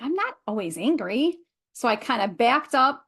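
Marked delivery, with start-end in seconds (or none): 0.0-1.4 indignant denial with stress on "not"; swooping pitch, rising at the end; mock-defensive retort to an accusation; retold protest. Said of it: "And I'm like".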